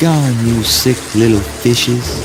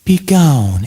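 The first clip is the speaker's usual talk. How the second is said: loud, higher, theatrical dismissal; commanding banishment; 4 semitones higher, 5 dB louder than usual. Acted. Banishing the apparitions he conjured, loud and commanding. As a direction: banish them with a forceful falling cry.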